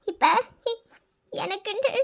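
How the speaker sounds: choked, halting words between sobs; tearful, broken start; breaking down. Acted. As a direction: try to speak through tears. Breaking down before he stops her.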